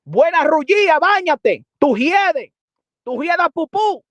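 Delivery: loud, high-pitched taunting rant; angry insults. Real, not acted. Hurling insults at Jennifer.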